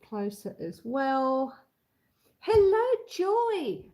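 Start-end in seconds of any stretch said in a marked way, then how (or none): 2.4-3.8 high, slow, drawn-out hello; sing-song greeting. Real, not acted. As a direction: call out a cheery hello. Greeting viewer on live stream.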